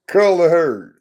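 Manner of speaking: loud, high, falling exclamation; emphatic call. Real, not acted. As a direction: call it out like a punchline. Repeats the phrase after laughing.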